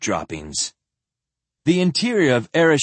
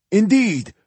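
higher, louder pampered priest; Indeed pitched up high as the old priest; high old zealot; 10 semitones higher, 4 dB louder than usual. Acted. Ereshkigal's high voice.

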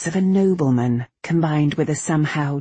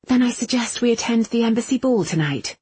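firm; 8 semitones higher, faster than usual. Acted. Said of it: "she said firmly".

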